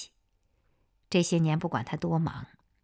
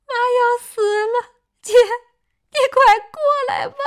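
high, loud, fast wailing plea; crying on the phone; 14 dB louder than usual. A narrator's reading. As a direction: wail it out in panic. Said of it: "夏季哭着给夏红打电话".

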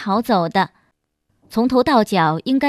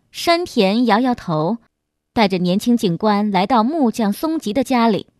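plain reading voice

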